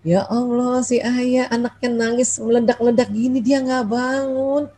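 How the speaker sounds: drawn-out, plaintive complaint, softly voiced; inward grumbling. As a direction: moan to yourself about the sleeping husband, stretching the last words. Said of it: "Untung ngebatinnya tetap panggil Allah".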